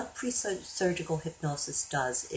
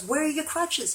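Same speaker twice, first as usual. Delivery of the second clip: high-pitched, astonished question; imitated alarmed surgeon; incredulous demand; 12 semitones higher, 4 dB louder than usual. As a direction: demand an explanation in disbelief. Surgeon demands to know about crutches.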